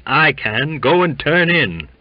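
high and loud dismissal; loud retort. A narrator's reading. Waite tells the cook to go to bed, loud retort. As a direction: shout him off.

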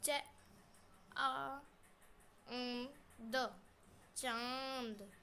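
उं, द, चांद spelled out slowly, sound by sound; slow spelling. Shown as a demonstration. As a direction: build चांद letter by letter, slowly. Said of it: "spelling out the word". (चांद धीरे धीरे जोड़कर बोलिए)

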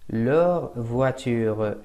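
Leur voiture spoken very slowly and clearly; slow model French. Shown as a demonstration. Teacher slowly modelling Leur voiture for students. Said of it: "شو بحط؟".

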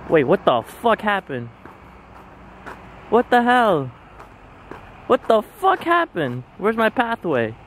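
high, baffled outburst; sudden high-pitched confusion; confused surprise. Real, not acted. Confused by vanished path.

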